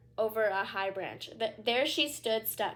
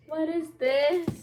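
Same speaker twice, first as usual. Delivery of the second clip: puzzled character voice; 6 semitones higher, 6 dB louder, slower than usual. Acted. Puzzled aside in odd voice.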